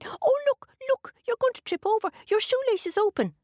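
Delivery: high squeaky leprechaun voice; tiny fairy man, high and tricky. A narrator's reading. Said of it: "And then he said".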